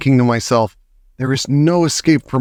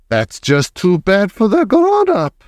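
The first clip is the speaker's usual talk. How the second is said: higher, dismissive witch voice; Grand High Witch shrugging off the question; callous Grand High Witch; 7 semitones higher than usual. A narrator's reading. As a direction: brush it off coldly as the witch. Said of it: "said the Grand High Witch".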